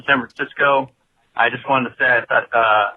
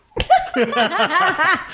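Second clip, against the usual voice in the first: high laugh. Real, not acted. Cohost laughs at caller.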